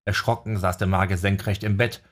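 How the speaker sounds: ordinary delivery, nothing marked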